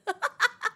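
high, loud laughter; giggling fit. Real, not acted. Streamer cracking up after meowing, real high laughter. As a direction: crack up helplessly.

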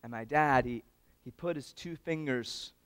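normal talking voice

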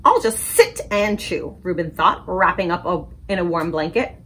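put-on lively picture-book read-aloud for kids; story time reading. A narrator's reading. Librarian reading a story to children, animated. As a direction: read it bright and animated to little listeners.

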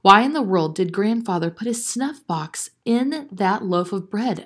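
usual speaking voice

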